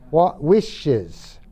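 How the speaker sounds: normal talking voice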